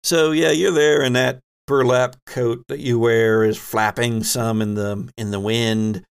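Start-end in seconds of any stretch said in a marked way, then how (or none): none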